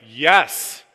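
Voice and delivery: a loud, delighted yes; emphatic yes. Retold or imitated. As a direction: burst out yes with enthusiasm. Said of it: "when I am emphatic, I am emphatic".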